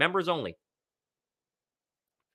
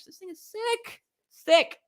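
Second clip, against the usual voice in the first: excited; slower than usual. Real, not acted. Gushing over the signed helmet.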